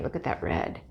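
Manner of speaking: breathy hushed whisper; whispered awe. Real, not acted. Whispering over the red paint to viewers. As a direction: murmur it almost to yourself in awe. Admiring colors, drops to whisper.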